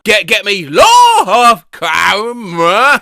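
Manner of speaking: loud, drawn-out mock bellow; slow, exaggerated shouted repeat; hammed-up loud drawl. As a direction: stretch LAW out into a slow shout. Exaggerated repeat of the garbled phrase.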